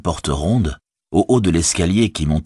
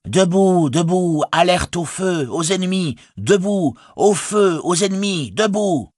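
high, ringing horn-like cry; alarm call; 13 semitones higher than usual. A narrator's reading. Rousing the whole Shire against enemies, ringing alarm cry. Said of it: "la sonnerie du pays de Bouc s'éleva".

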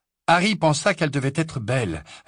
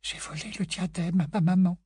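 whispered; 8 semitones higher than usual. A narrator's reading. Grey Lady confessing the theft to Harry, whispered. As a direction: breathe the secret out. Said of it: "à mi-voix".

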